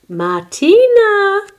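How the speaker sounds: high, loud, drawn-out call. A narrator's reading. Grandmother summons Martina, calling out. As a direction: sing out the name across a yard. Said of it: "called her abuelita".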